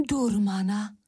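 ordinary delivery

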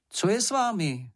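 high-pitched call, no real shouting; Šňupálek calling. A narrator's reading. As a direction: call out to friends below. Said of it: "zavolal Šňupálek". (vyšším hlasem zavolat)

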